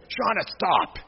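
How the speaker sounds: high, loud snap of your own name; shouting at yourself. Shown as a demonstration. Teacher shows the wrong way to stop yourself, high loud shout. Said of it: "don't do it out loud ... kinda go".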